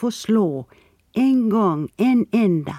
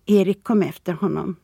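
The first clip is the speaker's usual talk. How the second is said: ordinary delivery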